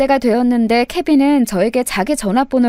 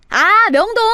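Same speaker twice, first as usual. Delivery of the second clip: surprised realization; 9 semitones higher, 3 dB louder, slower than usual. Acted. Realizing where foreigner wants to go.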